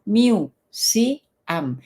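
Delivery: "miu, si, am" in three separate syllables. Shown as a demonstration. Teacher helping those who cannot say museum, syllable by syllable. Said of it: "no saben decir museo, dices".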